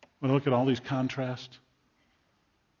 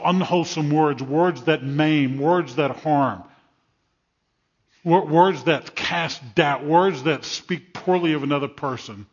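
slow, drawn-out, louder preacher's cadence; slow emphatic preaching; 5 dB louder, slower than usual. Acted. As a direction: lean hard on each word that harms. Preaching lines of harmful words.

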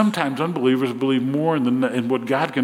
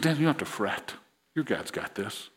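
much softer, almost breathed to a friend; hushed king's reassurance; 2 semitones lower, 10 dB quieter than usual. Acted. King softly comforting Daniel before the lions' den, hushed. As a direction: lean in and say it gently under the breath.

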